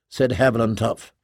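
ordinary delivery

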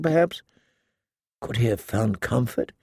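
ordinary delivery, nothing marked

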